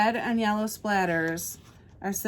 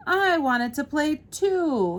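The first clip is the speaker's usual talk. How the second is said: higher, slow storybook whine; pouting complaint; 7 semitones higher, 6 dB louder, slower than usual. A narrator's reading. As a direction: draw it out with a pout. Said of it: "he complained with a pout".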